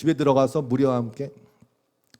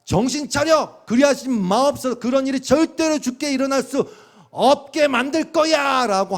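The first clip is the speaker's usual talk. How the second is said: Peter rebuking; 9 semitones higher, 5 dB louder than usual. Retold or imitated. Peter grabbing Jesus, loudly scolding him not to die. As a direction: shake him and rebuke him hard. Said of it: "베드로가 예수를 막 꾸짖으면서".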